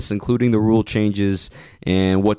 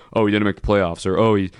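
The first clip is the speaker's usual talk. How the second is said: fast, dismissive parroting; sneering imitation of the writers; mocking quote; 4 semitones lower, faster than usual. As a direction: rattle off their tired argument. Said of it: "I'm sick of hearing this".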